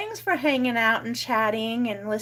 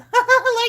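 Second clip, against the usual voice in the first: high laugh; 13 semitones higher, 9 dB louder than usual. Real, not acted. Laughs at dog.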